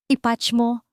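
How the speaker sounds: terse boss on the intercom; curt order. A narrator's reading. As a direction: give a brief, businesslike order. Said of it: "maikli niyang utos".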